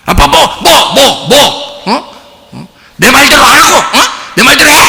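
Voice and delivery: acted child ordering dad around; bossy child. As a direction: mimic a bratty child giving commands. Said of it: "명령하고 막 그래요".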